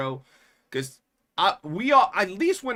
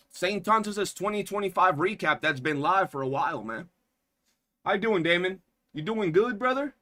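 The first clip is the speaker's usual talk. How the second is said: ordinary talk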